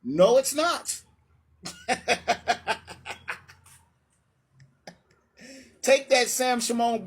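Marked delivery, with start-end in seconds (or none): 1.6-3.3 airy, voiceless laugh at the opponent; breathy mocking laugh. Real, not acted. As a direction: laugh under your breath, amused. Mocking laugh after winning point.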